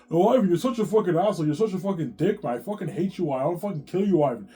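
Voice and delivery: loud, high imitation of a screaming row; crazed furious wife. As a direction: act out a wife tearing into her husband. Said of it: "This girl's fucking crazy".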